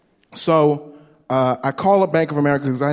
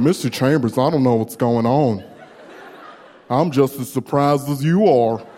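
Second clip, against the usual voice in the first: lightly voiced Southern bank man; southern accent; 3 semitones lower than usual. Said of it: "dude with the same accent".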